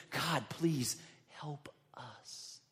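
slow, soft whispered plea; whispered prayer. Retold or imitated. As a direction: breathe the prayer out slowly.

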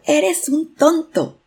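high, loud, drawn-out yell; angry shout. A narrator's reading. Furious boy shouts at the unknown voice, high angry yell. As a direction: yell it crossly, high and slow. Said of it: "Con mucho coraje, el niño gritó".

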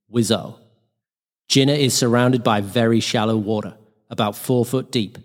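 ordinary delivery, nothing marked

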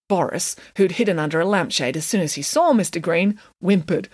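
plain reading voice

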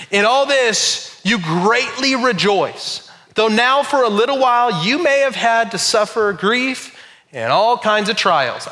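slow, deliberate Bible reading; scripture reading. Acted. Pastor reading the verse to the congregation, slow and deliberate. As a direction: read the verse with weight.

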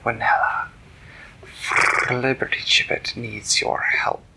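whispery cat voice with purrs; hushed, rolling feline voice; purring cat. Acted. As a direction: purr the words out like a cat. Cat Theodore wakes Manella, purring.

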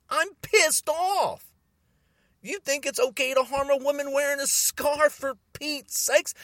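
high-pitched, heated outrage; real anger straining the voice upward; really pissed off. Real, not acted. Man outraged over a woman attacked for her scarf, really pissed off.

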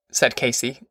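usual speaking voice

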